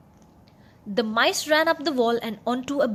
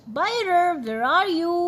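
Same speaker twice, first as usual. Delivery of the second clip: higher, louder searching call; 6 semitones higher, 4 dB louder than usual. A narrator's reading. Miller and his wife searching for their cat, calling loud. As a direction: call across the mill for the cat. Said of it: "the miller and his wife called".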